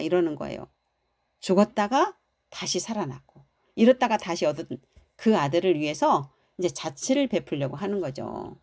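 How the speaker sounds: usual speaking voice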